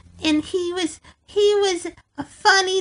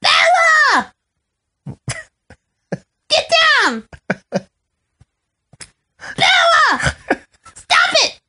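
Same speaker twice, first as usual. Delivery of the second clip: high, shouted scolding, mimicking Daddy-O; Daddy-O impression; 9 semitones higher than usual. Bella imitates Daddy-O telling her off, mock scolding shout. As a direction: bark out commands as a mock dog owner. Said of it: "Can Bella do an impression of me?".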